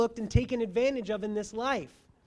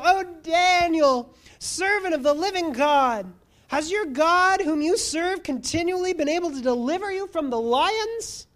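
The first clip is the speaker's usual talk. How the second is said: higher, louder anguished calling out; anguished cry; 7 semitones higher, 5 dB louder than usual. Acted. King calling to Daniel in the den, anguished cry. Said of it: "he cried out in a tone of anguish".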